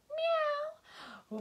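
very high, falling meow; cat meow. Acted. Showing children the cat's sound, meowing. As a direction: mew high like a little cat. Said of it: "she makes a sound like this".

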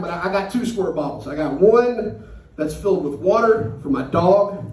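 usual speaking voice

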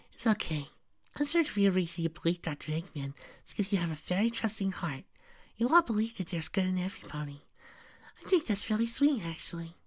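high, gentle, soothing voice; sweet little peacemaker, softly spoken. Acted. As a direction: plead kindly in a high, tender voice. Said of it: "her soft, sweet voice".

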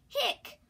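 loud high hiccup sound. Acted. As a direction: hiccup it out sharply. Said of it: "went the cat".

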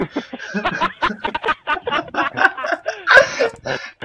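high wheezing laugh-cry; cracking up, can't get words out; helpless laughter mid-story; 1 laughing fit. Real, not acted.

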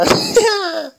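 high, far-off squeaky laugh; mischievous high giggle. Acted. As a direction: let out a shrill little giggle. Laugh after calling.

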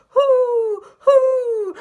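very high, loud hoots; howling owl. Acted. Outraged owl woken by a sneeze, howling hoots. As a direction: howl out long owl hoots. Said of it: "who howled".

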